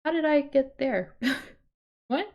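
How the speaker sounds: normal talking voice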